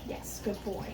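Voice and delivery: soft whispered praise, barely voiced. Real, not acted. Trainer quietly praising her dog, whispered. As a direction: breathe the praise gently to the dog.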